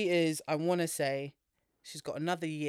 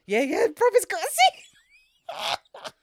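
much higher and louder, slow and stretched; laughing outrage that it got a sequel; incredulous glee; 10 dB louder, much slower than usual. Real, not acted. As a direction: shriek it out in amused disbelief. Gleeful disbelief about the sequel.

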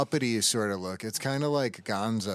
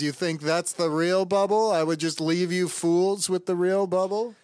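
higher, louder smug taunt; mocking scoff; 7 semitones higher, 5 dB louder than usual. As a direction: scoff at the fools. Character mocks them in recap.

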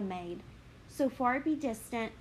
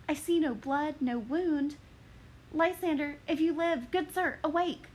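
put-on higher, breathless young woman; Helena lamenting; 3 semitones higher than usual. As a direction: mourn then startle at the body.

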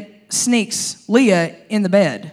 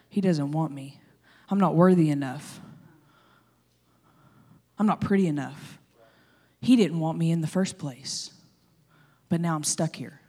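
much softer, lower, hurt inner voice; dejected Leah; 6 semitones lower, 9 dB quieter than usual. Retold or imitated. Leah overhears she is unwanted and speaks softly, hurt. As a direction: murmur it like someone who knows she is unwanted. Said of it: "standing there and going".

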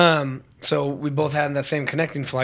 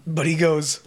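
ordinary talk, nothing marked

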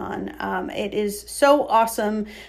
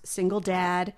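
ordinary talk

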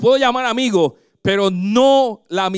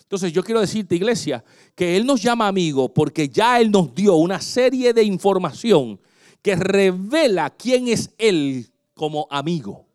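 ordinary delivery

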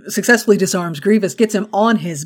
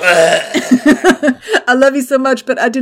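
drawn-out high love line, laughing; hammed-up Kylo; 5 semitones higher, 4 dB louder, slower than usual. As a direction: overplay the tortured sweetheart. Continues the Kylo bit.

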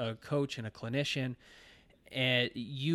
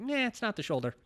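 high-pitched, offhand "nah"; dismissive; 7 semitones higher than usual. Acted. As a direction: wave the idea away in a higher voice. Acted dismissal of client's complaint.

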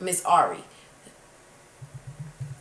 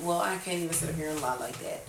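ordinary talk, nothing marked

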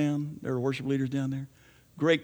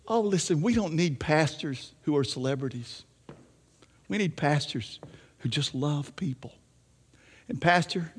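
ordinary talk

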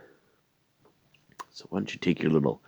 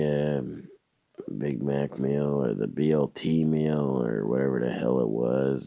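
normal talking voice

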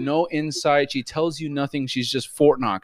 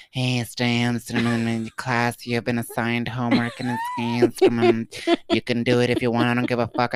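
flat, lowered mocking impression of cohost; mock monotone Jasmine; 3 semitones lower than usual. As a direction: parody her bored, scheming voice. Mocking cohost's inner monologue.

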